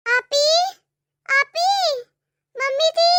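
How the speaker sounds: loud rising calls across the house; calling out. Acted. Bedridden bhabhi calling out loudly to her sister-in-law. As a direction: call out loudly for help.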